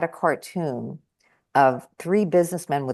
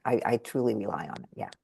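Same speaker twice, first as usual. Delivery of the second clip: usual speaking voice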